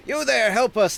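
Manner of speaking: high, pleading villager's call; urgent call for help. Acted. Villager helping an old man hails a half-giant, urgent call. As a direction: hail a stranger for rescue.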